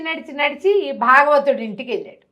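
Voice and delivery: ordinary delivery, nothing marked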